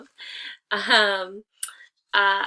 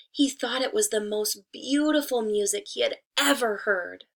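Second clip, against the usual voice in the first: lively, expressive read-aloud, each day called out louder; story narration; 5 dB louder than usual. Acted. Teacher reading a fable aloud to her pupils, expressive storytelling. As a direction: read the fable to the class with care and warmth.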